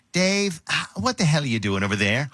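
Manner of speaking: sigh, then scold like a fed-up manager; Rob. Acted. Host makes fun of old boss calling him, exasperated. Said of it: "this is a voice that, uh, is familiar to me".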